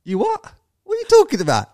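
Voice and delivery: high, shocked disbelief at his mate's claim; incredulous. Retold or imitated. Reacting to his mate spotting Tom Hanks on the beach, stunned disbelief.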